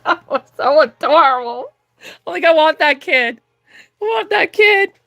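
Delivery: high, squealy adoring voice; real delight, breaking into a crying squeal; gushing. Real, not acted. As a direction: squeal as if the cuteness is too much. Gushing over an adorable child.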